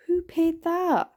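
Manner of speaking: high, slow disbelief; incredulous. Real, not acted. Indignant at price.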